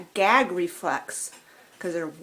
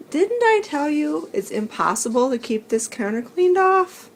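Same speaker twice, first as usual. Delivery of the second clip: exasperated laughing; 8 semitones higher, 5 dB louder than usual. Real, not acted. Counter messy again on return.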